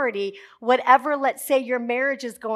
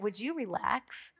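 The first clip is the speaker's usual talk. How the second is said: calm God voice; 2 semitones lower, 12 dB quieter, slower than usual. Retold or imitated. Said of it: "The Lord's like".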